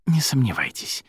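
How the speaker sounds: plain reading voice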